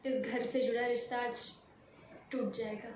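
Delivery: quietly, haltingly mourn a broken bond; subdued sorrow. Acted. Leaving a home of many years, subdued and sorrowful.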